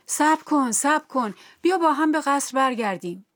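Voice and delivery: desperate shout to stop her leaving; calling out. Acted. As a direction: call urgently to someone running away. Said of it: "فریاد زد".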